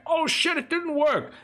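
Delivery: sudden high squeaky panic; mock dismay. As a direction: squeal like a fooled player. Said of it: "be like".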